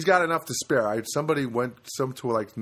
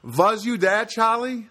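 put-on louder, higher Yiddish accent, Brando style; Brando's Yiddish accent; 9 semitones higher, 6 dB louder, slower than usual. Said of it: "Marlon Brando would say in a Yiddish accent".

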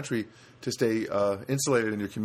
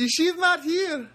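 high, slow imitation of a Filipino accent; 4 dB louder, much slower than usual. Imitating the guard answering the phone, Filipino accent. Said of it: "his Filipino accent".

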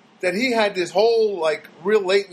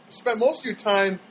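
ordinary delivery

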